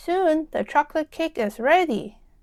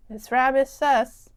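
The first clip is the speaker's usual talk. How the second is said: plain reading voice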